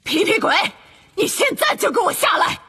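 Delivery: stern shout. A narrator's reading. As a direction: roar the order at him. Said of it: "麦格教授大声吼道".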